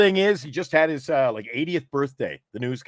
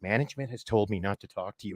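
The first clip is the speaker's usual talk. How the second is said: slightly lower, hushed mock-nervous newsman; paranoid newsman; 5 semitones lower, 8 dB quieter than usual. Newsman refusing to chat with host, lightly paranoid. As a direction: imitate a wary man keeping his voice down. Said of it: "paranoid guy".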